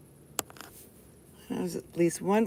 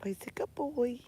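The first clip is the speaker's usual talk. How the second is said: soft secret praise for the dog; whispered dog praise; 6 semitones higher, 4 dB quieter than usual. Real, not acted.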